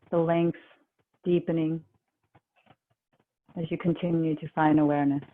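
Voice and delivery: calm, even, unhurried relaxation guide; guided meditation. Real, not acted. Yoga teacher guiding the class into relaxation, calm guided meditation.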